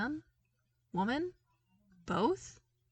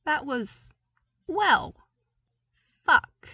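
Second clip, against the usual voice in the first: rising, much louder, bursting out into a curse; stunned swearing; 6 semitones higher, 8 dB louder, slower than usual. A narrator's reading. Luke grasping the committee is for him, shocked loud curse. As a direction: build up then blurt the swear in shock.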